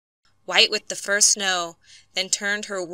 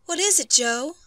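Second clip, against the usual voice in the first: higher-pitched, worried question; anxious wife; 7 semitones higher than usual. A narrator's reading. Wife to her hurt husband, anxious. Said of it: "the wife asked anxiously".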